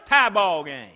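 loud, triumphant score call; shouted score. Acted. Preacher shouting the tied score to the congregation, loud. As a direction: call it over the crowd roar. Crowd erupts after tying shot.

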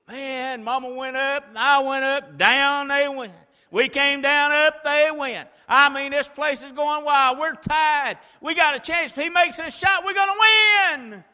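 high, loud breathless play-by-play; excited retelling. Acted. Preacher reliving the final seconds for the church, excited shouting. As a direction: build the crowd's frenzy. Reliving the frantic game finish.

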